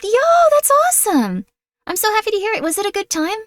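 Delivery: high excited cheer; bright and thrilled, high. Real, not acted. Happy reaction.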